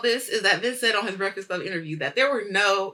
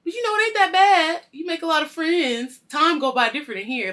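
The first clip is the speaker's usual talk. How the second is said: lightly voiced old man, higher and louder; a hint of an old-timer, pitched up; old man in jail; 9 semitones higher, 7 dB louder than usual. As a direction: play the old inmate making light of jail. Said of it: "then the old man is like".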